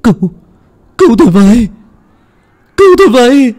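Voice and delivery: high, loud, fast panicked pleading; desperate screaming. Acted. Pinned-down son shrieks that he killed no one, high and fast. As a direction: beg for your life, frantic.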